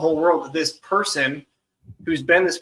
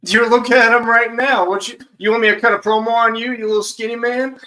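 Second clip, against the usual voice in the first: cocky trash talk; 5 semitones higher, 6 dB louder than usual. Acted. Wrestler turns trash talk on host.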